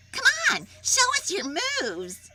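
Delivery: high, loud egging-on; eager coaxing. Acted. Hyena eagerly urging Jim to dance. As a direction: cheer him to show off.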